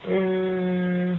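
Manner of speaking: ordinary talk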